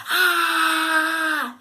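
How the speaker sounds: a loud roaring cry of anger; angry roar. Shown as a demonstration. Teacher showing the class how anger makes you roar. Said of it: "Angry can make you roar".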